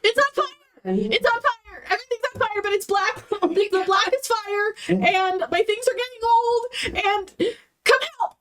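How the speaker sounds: high frantic yelling; shouting over fire alarm. Acted.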